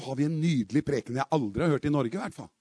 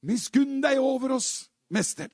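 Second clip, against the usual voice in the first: higher, drawn-out plea, only mildly raised; retold crying out; 6 semitones higher than usual. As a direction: call out slowly for mercy, holding back volume. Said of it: "som ropte".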